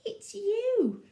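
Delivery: high-pitched amused character voice; laughing reply. A narrator's reading. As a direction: call it out in playful amusement. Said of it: "they laughed and said".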